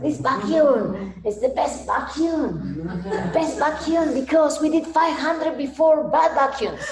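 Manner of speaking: slow, high, laughing German ad voice; hammed-up German vacuum salesman, chuckling; mock German accent. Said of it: "this vacuum was a German accent".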